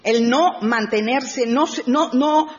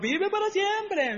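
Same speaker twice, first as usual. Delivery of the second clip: high, soft, slow mock salute; insincere flattery; 10 semitones higher, 6 dB quieter, slower than usual. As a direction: say it like a fawning courtier who doesn't mean it. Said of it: "no como los otros". (adulación fingida, aguda y suave)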